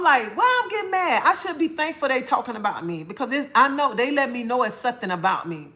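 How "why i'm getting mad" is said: high-pitched mock puzzlement; puzzled self-question. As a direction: ask yourself, pitched up, as if baffled. Acts out her own reasoning.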